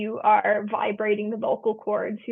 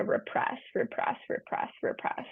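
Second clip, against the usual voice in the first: fading whisper; 9 dB quieter than usual. Shown as a demonstration. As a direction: repeat it softer each time until it is a whisper. Repression fading into silence.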